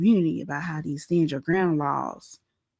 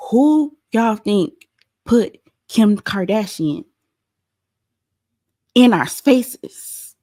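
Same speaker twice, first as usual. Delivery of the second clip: slow and louder, every word weighted; rhetorical question; 4 dB louder, much slower than usual. Real, not acted. Host blaming [name] to viewers, slow and louder, each word weighted. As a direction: drag out the question to land the blame.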